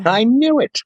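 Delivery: very high gleeful voice of a vindicated wife; excited told-you-so. Retold or imitated. Wife crowing to doubting Jeff, very high and gleeful. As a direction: squeal it in triumph.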